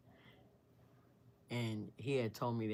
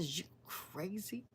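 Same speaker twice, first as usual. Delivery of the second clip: high, drawn-out disbelief, kept soft; incredulous challenge; 7 semitones higher, 6 dB quieter, slower than usual. Retold or imitated. She turns on her attacker, incredulous. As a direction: question his sanity in a slow high voice.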